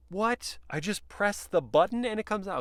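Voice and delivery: high, disbelieving What; sudden jump up in pitch, amazed; startled surprise. Real, not acted. Reviewer amazed the rear screen pops out.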